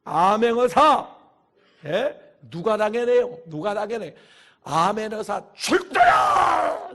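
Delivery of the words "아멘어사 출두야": slow, stretched proclamation shout; drawn-out mock herald call; royal inspector's herald cry. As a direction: announce the inspector like a crier. Mimics royal inspector's arrival cry.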